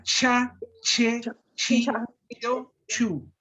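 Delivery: cha, che, chi, cho, chu said hard, like a ch; strong ch variant. Shown as a demonstration. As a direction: snap out each syllable. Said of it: "Se pronuncia tan fuerte". (ch fuerte de algunas variantes)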